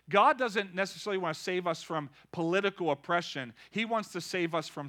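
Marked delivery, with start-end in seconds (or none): none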